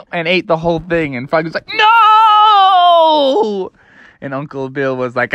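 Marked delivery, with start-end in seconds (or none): 1.7-3.7 high, loud wailing "No!"; hammed-up frog heartbreak; anguished cry. A narrator's reading. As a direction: wail in mock despair. Froggy's sandwich eaten.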